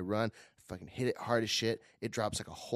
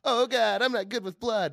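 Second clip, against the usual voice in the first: 1 panicky receptionist; 12 semitones higher, 8 dB louder than usual. Retold or imitated. Receptionist panics about blood.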